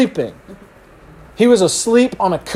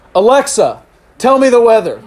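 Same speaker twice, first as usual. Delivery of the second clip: raised, louder voice-assistant command; commanding a device; 5 semitones higher, 5 dB louder than usual. Pretend. Owner ordering Alexa about, raised confident command. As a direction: address the smart speaker firmly.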